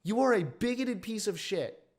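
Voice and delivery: high, loud angry retort. Real, not acted. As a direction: tell them off hard.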